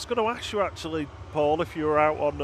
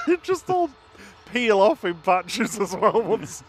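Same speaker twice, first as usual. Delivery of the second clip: laughing through the words, louder; 7 semitones higher, 7 dB louder than usual. Real, not acted. Host jokes about glitter peeling off, laughing through it. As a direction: get the words out while cracking up.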